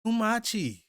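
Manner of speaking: high, loud call of a name. Retold or imitated. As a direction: call out to Kuma across the chaos. Character calling out to Kuma.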